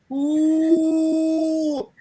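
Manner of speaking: high, far-off mock ghost ooh; ghost moan answering. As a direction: moan back like a hidden ghost. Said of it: "him hear the person say".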